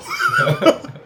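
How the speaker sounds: burst of laughter. Real, not acted. Laughing at sad-story joke.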